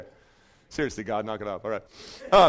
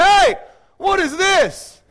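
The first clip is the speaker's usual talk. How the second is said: loud, high, slow outraged yell; 13 semitones higher, 9 dB louder, much slower than usual. Acted. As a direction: bellow it at the father-in-law who tricked you. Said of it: "comes running out and says".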